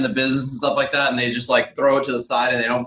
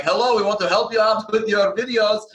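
higher, heavy fake Russian accent; mock Russian accent; 7 semitones higher than usual. Greeting realtors at an open house, in a mock Russian accent. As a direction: ham up a Russian accent like a stranger at the door. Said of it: "speaking Russian accents".

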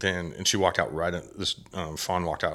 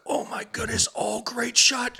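much higher, breathy squeal of joy, slowed on great shot; excited shriek. Retold or imitated. Hugging the hunter after her shot, high excited squeal. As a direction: squeal it like you can't contain the thrill. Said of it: "I was so freaking excited".

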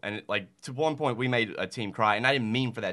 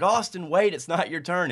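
high, loud call to stop a player; 10 semitones higher, 6 dB louder than usual. Retold or imitated. Coach calls a fast kid to stop mid-game, loud and high. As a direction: shout across the field to hold him back. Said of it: "And I was like".

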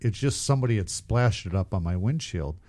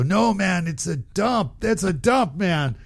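higher, amused car wash worker; car wash guy mocking, raised pitch; laughing car wash guys; 7 semitones higher, slower than usual. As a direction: tease him, pitched up and laughing. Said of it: "And they go".

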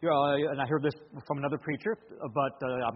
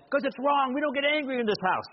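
higher, hammed-up yelling parent; mock angry yell; 8 semitones higher, 3 dB louder than usual. Shown as a demonstration. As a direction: snap at the kid like a losing-it dad. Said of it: "I can yell at him and say".

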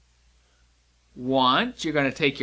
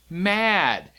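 usual speaking voice

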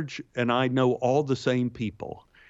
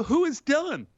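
much higher, baffled crew voice; crew member objecting; 13 semitones higher than usual. Retold or imitated. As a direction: ask it high as though nobody has heard the name. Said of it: "And they go".

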